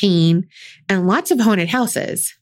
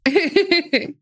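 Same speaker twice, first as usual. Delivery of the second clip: high, short giggle; amused laugh; 9 semitones higher than usual. Real, not acted. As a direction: giggle at the muddle. Laughs over muddled numbers.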